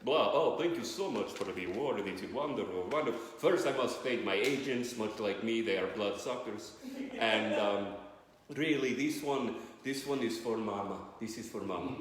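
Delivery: low, hammed-up vampire accent; Dracula. Vampire thanking agents and Mama for an award, low accented voice. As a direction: give an acceptance speech as a comic vampire. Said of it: "did Dracula win an Oscar".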